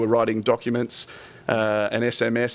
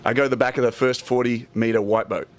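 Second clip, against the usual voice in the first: ordinary talk, nothing marked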